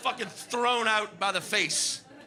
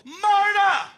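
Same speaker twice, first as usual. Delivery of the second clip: loud shout across the bar; calling out; 9 semitones higher, 5 dB louder than usual. Retold or imitated. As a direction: bellow for Marta in the back. Calling out to the back.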